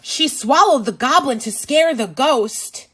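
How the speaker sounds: ordinary delivery, nothing marked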